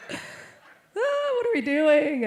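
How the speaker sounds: high, slow words after a laugh; retold giddy wail, loud and drawn out; laughing disbelief. Laughing at their awkward moment.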